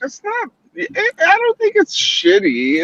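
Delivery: indignant disbelief. Real, not acted. Defending the show against "shitty.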